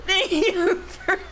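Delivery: high, squeaky break into real laughter; laughing. Real, not acted. Reader loses it thanking the game, laughing. Cracks up repeating the words.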